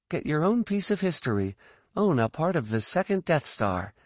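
put-on high, lightly pitched young pilot voice, musing then pitching; Sial, wry daughter figure. A narrator's reading. Sial jokes to Tycho about selling wreckage, high and playful.

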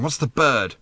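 usual speaking voice

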